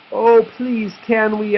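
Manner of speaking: high eager begging; pleading piglets. A narrator's reading. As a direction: beg sweetly like little pigs. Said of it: "asked the pigs".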